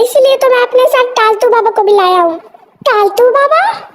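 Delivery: normal talking voice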